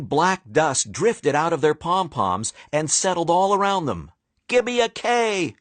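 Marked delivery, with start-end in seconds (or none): 4.5-5.6 high cheerleader call; cheer. Acted. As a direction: pump up the crowd. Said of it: "shouted the cheerleaders".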